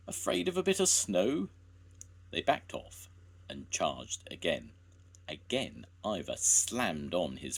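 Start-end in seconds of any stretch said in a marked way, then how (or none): 0.1-1.5 teasing. A narrator's reading. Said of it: "laughed Jones".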